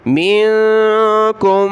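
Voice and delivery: drawn-out recitation with ghunna; slow recitation. Shown as a demonstration. Teacher recites the verse slowly. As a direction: chant it slowly.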